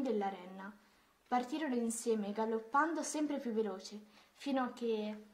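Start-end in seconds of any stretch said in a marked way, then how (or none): none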